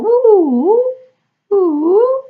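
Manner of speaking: high loud whistle-like woo; playful high woo sound; clown whistle woo. Retold or imitated. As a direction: hoot it like a toy whistle. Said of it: "like that".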